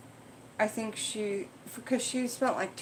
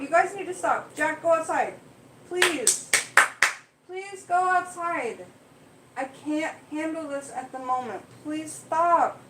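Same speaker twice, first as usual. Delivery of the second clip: high, raised, pleading commands; pleading with cats; 5 semitones higher than usual. Real, not acted. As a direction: beg the cat to go outside, raised voice.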